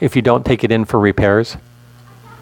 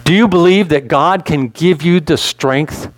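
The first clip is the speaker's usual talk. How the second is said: normal talking voice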